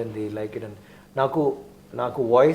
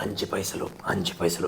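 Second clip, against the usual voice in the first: bass voice; 6 semitones lower, 6 dB quieter, faster than usual. Shown as a demonstration. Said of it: "It's not like Onion is like".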